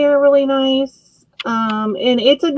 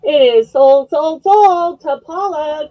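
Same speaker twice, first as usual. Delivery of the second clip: auctioneer call; 5 semitones higher, 4 dB louder, slower than usual. Real, not acted. Auctioneer closing the sale.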